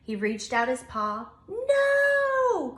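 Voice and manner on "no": high loud alarmed cry; cried no. Acted. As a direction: stop him with a panicked cry. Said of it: "cried Bear, Hedgehog, and Mouse".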